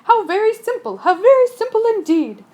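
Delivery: gleeful eureka; delighted. Acted. Sea serpent delighted by his own idea.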